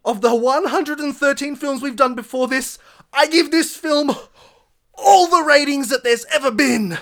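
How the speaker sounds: hammed-up grand proclamation, cracking at the end; over-the-top dramatic declaration. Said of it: "he's about to bust a lung".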